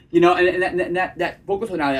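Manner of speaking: ordinary delivery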